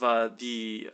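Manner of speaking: ordinary delivery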